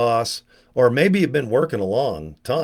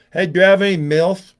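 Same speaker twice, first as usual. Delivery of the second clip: speech impediment impression; 5 semitones higher, 4 dB louder than usual. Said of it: "really bad impression of someone with a speech impediment".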